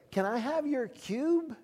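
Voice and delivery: high, slow, hammed-up asking voice; mock pleading request. Preacher imitating a Satanist who wants his cube, mock pleading. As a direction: draw it out high like a mock plea.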